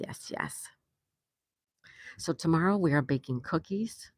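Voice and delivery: ordinary delivery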